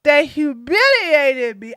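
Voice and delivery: slow, mock-tearful candidate lamenting a loss; whiny sore loser. Defeated candidate laments losing to Trump, mock-tearful. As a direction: wail it in drawn-out, fake sobs. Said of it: "She was like".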